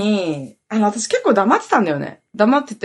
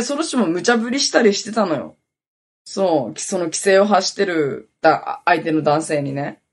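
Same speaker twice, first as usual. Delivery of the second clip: normal talking voice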